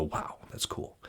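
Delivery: breathy whispered wow. Retold or imitated. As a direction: whisper it in awe. Imagined reader reaction.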